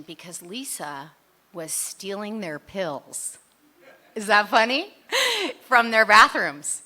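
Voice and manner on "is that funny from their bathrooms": loud question breaking into a laugh. Real, not acted. Speaker laughs at her own story to the room, loudly. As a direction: ask it, then burst out laughing.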